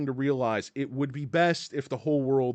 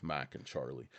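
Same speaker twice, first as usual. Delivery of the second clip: ordinary delivery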